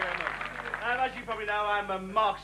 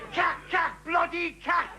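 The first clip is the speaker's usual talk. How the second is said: higher, shouting cack cack; ranting refrain; 6 semitones higher, slower than usual. Acted. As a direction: bark it in disgust.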